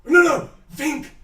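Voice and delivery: high excited voice; excited idea. Acted. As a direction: blurt out the idea. NPC excitedly gets idea.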